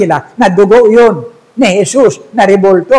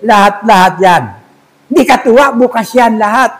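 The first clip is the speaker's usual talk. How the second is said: broken-Tagalog owner, a bit higher; generous boss making an offer; Chinese shopkeeper; 3 semitones higher, 5 dB louder than usual. Acted. Chinese owner offering the robber a job and a car, lightly accented.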